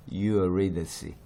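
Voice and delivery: "Eurydice" slowly as yu-RI-duh-see; slow English "Eurydice" ending in "see"; English pronunciation. Shown as a demonstration. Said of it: "영어로는".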